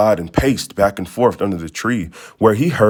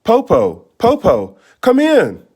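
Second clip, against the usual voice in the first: louder, high excited calls; children shouting; 9 semitones higher, 5 dB louder than usual. Acted. Children shout to let grandmother in, excited. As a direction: call out gladly to grandmother. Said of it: "They shouted".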